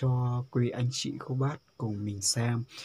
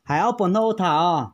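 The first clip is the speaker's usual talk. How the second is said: to someone hard of hearing; 5 semitones higher, 10 dB louder than usual. Real, not acted. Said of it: "phải nói to to bà cụ mới nghe được".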